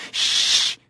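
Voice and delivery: long hissed hush for silence; soft, sharp hissing hush; hissed shush. A narrator's reading. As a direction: hiss them quiet with a drawn-out shh. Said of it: "he hissed".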